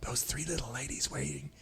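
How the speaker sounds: hushed urgent backstage whisper; stage whisper to a co-host. Acted. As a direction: whisper so the guests won't hear.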